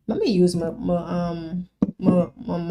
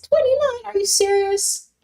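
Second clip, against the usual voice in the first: much higher, incredulous cry; real amazement; 13 semitones higher than usual. Real, not acted. Seeing twenty-nine viewers in chat, amazed and much higher. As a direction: pitch it way up in disbelief.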